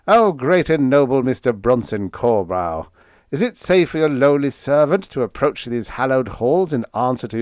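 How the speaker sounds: bow and scrape with a wink, over the top; young assistant teasing the chief designer, mock-humble; mock grovelling servant. Vern's mock servile greeting.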